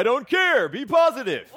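chuckling out a corny dad joke; real laugh on the catchphrase. Real, not acted.

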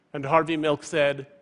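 ordinary talk

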